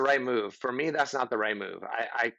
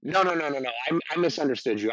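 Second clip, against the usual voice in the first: quick, louder flustered take-back; backpedaling uncle; 3 semitones higher, 3 dB louder, faster than usual. Retold or imitated. Uncle hastily retracting his words, lightly voiced. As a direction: hurry to undo the offense. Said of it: "he can go".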